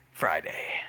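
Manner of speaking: whispering. Acted. Conspiratorial plotting.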